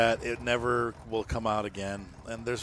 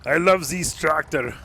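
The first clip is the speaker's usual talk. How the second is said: loud, slow, higher-pitched mock French accent; fake French accent; 5 semitones higher, 8 dB louder, slower than usual. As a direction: ham up a bad French accent, drawn out. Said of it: "this is not a fake French accent".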